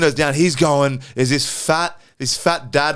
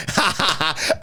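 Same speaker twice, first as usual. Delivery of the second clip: mocking laughter; 3 dB quieter than usual.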